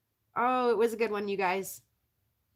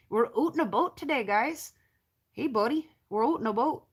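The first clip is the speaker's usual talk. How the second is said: hammed-up Canadian "oot" and "boat", lilting; bouncy mock-Canadian accent; 2 semitones higher than usual. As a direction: play a chummy Canadian with rounded vowels. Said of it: "with a Canadian accent".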